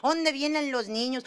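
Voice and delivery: normal talking voice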